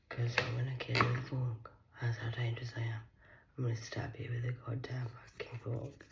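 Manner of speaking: menacing unvoiced whisper; whispered threat. Whispering a mock threat at Hannah.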